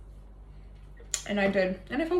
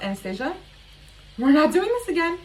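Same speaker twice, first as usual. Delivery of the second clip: higher, louder scolding to herself; stern self-scolding; 6 semitones higher, 4 dB louder than usual. Retold or imitated. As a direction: tell yourself off firmly. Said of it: "I'm trying to teach myself".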